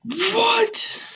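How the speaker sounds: squeaky high disbelieving what; shocked what. Real, not acted. Reacting to the fifty-nine extra murders, a shocked high squeak. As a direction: gasp out a stunned, squeaked what.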